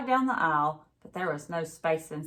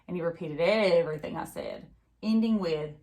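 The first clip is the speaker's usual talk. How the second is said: ordinary delivery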